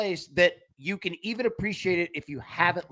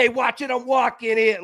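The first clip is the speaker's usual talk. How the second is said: put-on loud New York accent, pitched up; classic New York; 4 semitones higher, 4 dB louder than usual. Co-host playing a New York pedestrian, loud classic accent. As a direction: call it out like an old-school New Yorker. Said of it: "the classic New York".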